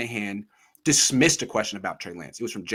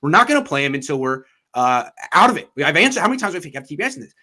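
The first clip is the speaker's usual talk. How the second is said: imitating exasperation, voice rising louder; annoyed coach; 3 semitones higher, 6 dB louder, faster than usual. Coach snapping back at a repeated reporter question, annoyed. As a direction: retell it as fed-up irritation, speeding up. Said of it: "he was annoyed by the question".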